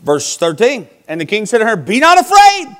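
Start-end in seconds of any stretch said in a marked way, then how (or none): 1.8-2.8 high, loud acted proclamation; booming command. King telling the witch not to fear, commanding. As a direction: call it out as a king's order. Preacher acts out the king's command.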